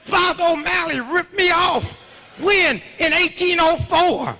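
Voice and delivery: put-on high, loud old man's complaint; hollering old man. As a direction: yell it out like an outraged old man. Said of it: "start hollering".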